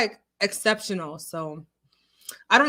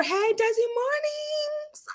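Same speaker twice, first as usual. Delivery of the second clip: sing-song greeting; 14 semitones higher, slower than usual. Real, not acted.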